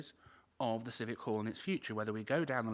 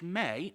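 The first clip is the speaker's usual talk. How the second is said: may pitched up and punched; stress on may; 4 semitones higher, 4 dB louder than usual. Real, not acted. Councillor cautioning against discounting other bids, stressing may. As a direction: lean firmly on the word may. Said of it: "I emphasise the word may".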